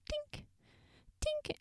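tiny, very high, soft tinks; ping it like glass being tapped; imitating a faint bottle tink; bottle tink imitation. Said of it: "that bottle tinking sound".

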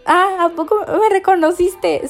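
high, breathless fan squeal; thrilled disbelief. Retold or imitated. Starstruck fan asking her idol if he recognized her, thrilled squeal. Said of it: "Y yo así de".

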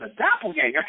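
high-pitched imitation of disbelief; incredulous echo. Retelling a DJ's reply to news of a lookalike, incredulous. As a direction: echo it back as a high, amazed question.

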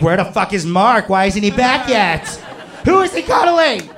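comic panic building question by question; mounting panic. Retold or imitated. Inner panic rising to a shriek.

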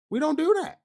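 a high incredulous second take; indignant high repeat. Real, not acted. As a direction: jump way up in pitch on "We don't do that". Repeated emphasis.

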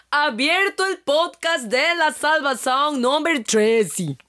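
slow, stretched grand announcement; mock announcer. Co-host opens the podcast in a hammed-up announcer voice. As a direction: announce it like a big show opener.

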